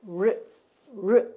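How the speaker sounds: 르 as a rounded English r, 'rwuh'; English R sound. Shown as a demonstration. Teacher showing what the letter R says, rounded 르. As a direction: say 르 with an r that starts from rounded lips. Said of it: "이렇게 되죠?".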